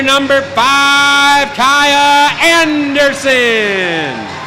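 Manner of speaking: high, stretched last starter; drawn-out hype call. Acted. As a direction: draw the name out big.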